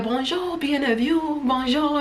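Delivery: high soft French hostess greeting; imitating the greeter. Boat greeter welcoming guests, soft high French hello. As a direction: sing out the welcome softly, up high. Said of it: "She's like".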